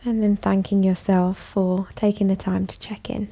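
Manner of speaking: calm, even voice with long pauses; guided meditation. Acted. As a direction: guide them gently inward, leaving space after each phrase. Leads a bedtime meditation.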